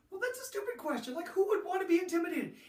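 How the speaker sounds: imitating an athlete who calls the question stupid; scoffing player. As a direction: ham up the kid who finds it silly.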